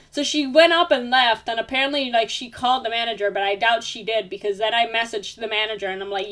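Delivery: ordinary delivery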